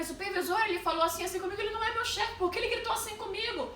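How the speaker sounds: high, fast, upset retelling; nervous complaint. Retold or imitated. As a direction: rush out the complaint to a superior. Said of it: "eu comecei a ficar nervosa".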